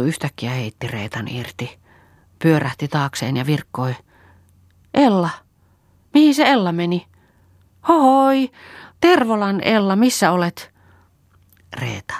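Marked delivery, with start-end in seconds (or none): 4.9-10.7 high, loud call into the forest; searching shout, pitched up high. A narrator's reading. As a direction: holler for a missing friend. Kerttu calls for vanished Ella.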